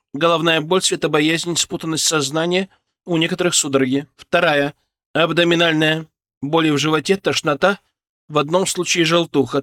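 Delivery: brief the team, calm and efficient; calm businesslike briefing. Acted. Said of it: "Её голос был спокойным, деловым, без тени паники".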